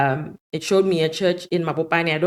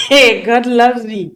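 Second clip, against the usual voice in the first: louder, slow delighted exclamation; joyful outburst; 5 semitones higher, 10 dB louder, slower than usual. Retold or imitated. Hearing the call for baptisms at the camp meeting, a loud slow joyful outburst. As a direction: let the joy burst out, stretched and loud.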